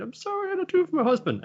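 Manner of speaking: squeaky falsetto wife, fast and high; high falsetto woman. Retold or imitated. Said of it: "Von saying".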